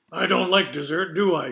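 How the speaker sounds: put-on slurred, cheeky old woman voice; gruff slurred voice. Judy teasing about dessert.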